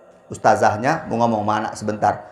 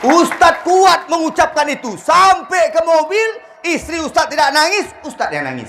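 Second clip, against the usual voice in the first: high teasing wife voice; mock wife voice. High far voice mocking wife. (istri menggoda dengan suara tinggi)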